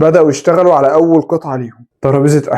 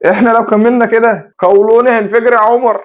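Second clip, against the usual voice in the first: higher, half-joking complaint; groan-like joke with a touch of pain, pitched up; pained amusement; 7 semitones higher than usual. A narrator's reading. Hany jokes to Omar about their cheap food, mildly pained. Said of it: "هاني كان بيقول بضحك ووجع".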